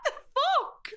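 high, drawn-out squeal of disbelief; shocked excitement. Real, not acted. Friend hears about the Florida trip, shocked excitement. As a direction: react in astonished, squealing amazement. Hearing the Florida holiday news.

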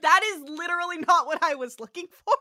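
loud, fast, exasperated retort with a laugh; mock exasperation. Host voices lawyer fed up with Spock's answer, mock exasperated.

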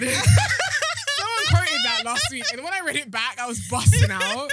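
talking through big laughs; cracking up mid-sentence; laughing. Real, not acted. Laughing hard while telling.

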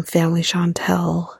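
usual speaking voice

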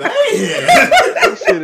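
high, slow, laughing wheeze. Real, not acted. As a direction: squeeze it out laughing. Laughing at the joke.

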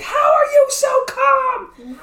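very high, loud, slow mock shriek; panicked girlfriend. Girlfriend shrieking at him after the crash, very high. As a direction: stretch it out in a shrill panic. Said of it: "And she's like".

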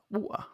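"water" with a glottal stop for the T; dropped T. Shown as a demonstration. Defending own pronunciation, "water" with T dropped. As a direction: leave the T out of "water". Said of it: "I can't pronounce T's".

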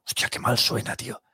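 hushed, breathy aside to yourself; softly voiced inner comment; whispered thought. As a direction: whisper it like a private reaction. Retold private thought about bad sound.

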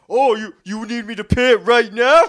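loud, high mouthing off; super lippy. Retold or imitated. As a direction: imitate a lippy kid talking back. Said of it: "super lippy".

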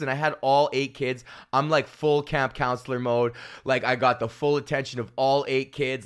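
ordinary talk

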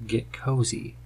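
ordinary talk

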